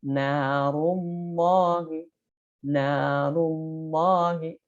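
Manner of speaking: very slow narullahi, heavy dark lam; thick lam after dhammah. Shown as a demonstration. Teacher demonstrates dhammah before jalalah. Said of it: "kalau dhammah bagaimana?".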